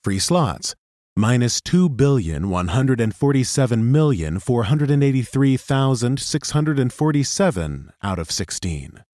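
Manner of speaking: plain reading voice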